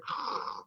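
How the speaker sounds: long unvoiced letting-go sigh; soft audible release sigh; deep sigh. Shown as a demonstration. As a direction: breathe out and let it drop. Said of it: "And I'm just like".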